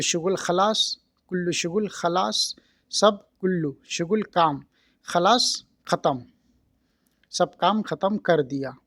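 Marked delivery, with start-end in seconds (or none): none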